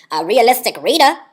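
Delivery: loud, high, exaggerated catchphrase; Chris Crocker. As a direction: blurt it with dramatic flourish. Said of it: "as Chris Crocker would say".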